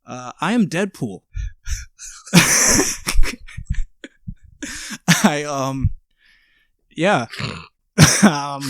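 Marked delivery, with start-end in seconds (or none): 1.3-4.3 airy high-pitched laugh; high breathy laugh. Real, not acted. Laughing under costume mask.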